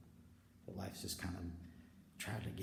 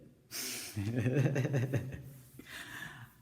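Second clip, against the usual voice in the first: loud, high bursting laugh; big real laugh; 4 semitones higher, 8 dB louder than usual. Real, not acted.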